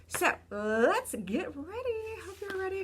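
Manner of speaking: high, drawn-out "let's get ready"; excited rallying. Real, not acted. Teacher rallying the class to get ready, excited. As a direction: pump the class up, slowly and high-pitched.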